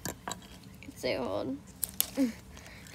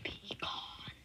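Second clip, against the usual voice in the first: whisper; 16 dB quieter than usual. Pretend. Banishing the monster.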